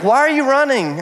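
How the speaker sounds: high, questioning wife's voice; imitated wife. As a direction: pitch it way up as the puzzled wife.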